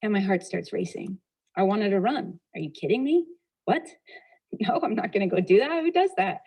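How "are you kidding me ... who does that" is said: incredulous. Retold or imitated. Wife balking at husband's suggestion, mock incredulous. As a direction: balk at it, pitch climbing to the final question.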